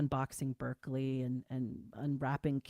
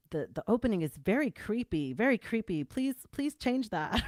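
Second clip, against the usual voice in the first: higher, emphatic retelling of a worried note; mock pleading; 6 semitones higher, 5 dB louder than usual. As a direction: read out a complaint in amused, exaggerated alarm. Acts out a funny critique note.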